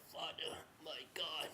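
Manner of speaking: very soft, near-whispered call; hushed plea. Acted. Jesus on the cross to God, hushed plea. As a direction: call out faintly, as if dying.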